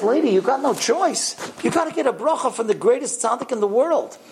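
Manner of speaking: high-pitched, emphatic urging; urgent insistence. Retold or imitated. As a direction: press her hard, pitch well up. Stranger insists she must go.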